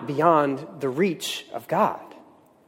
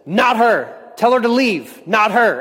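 higher, urgent mock call; raised voice, pitched up; retold calling out; 5 semitones higher, slower than usual. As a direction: act out a stranded man calling a warning. Acting out stranded man calling out.

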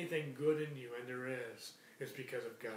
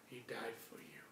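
hushed whisper, no voice; 3 semitones lower, 9 dB quieter than usual. Acted. Preacher whispering to the congregation about the cross. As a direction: drop to a breath for the weight of it. Tender climax of sermon point.

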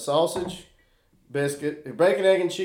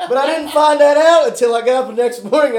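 laughing disbelief; 9 semitones higher, 11 dB louder than usual. Real, not acted. Reveals finding out the bill.

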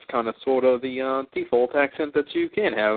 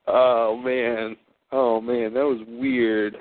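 normal talking voice

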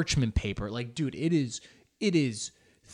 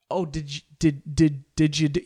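higher, stuttered questioning; mock interrogator; 4 semitones higher, 4 dB louder than usual. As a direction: fire off halting questions, pretending to interrogate. Mock interrogator demanding confession.

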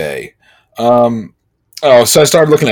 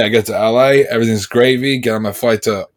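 usual speaking voice

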